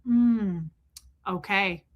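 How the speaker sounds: emotionless trained-auditor reply; lifeless, unreacting okay; dead acknowledgment. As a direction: respond as if nothing registers. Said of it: "fully trained dead people to go".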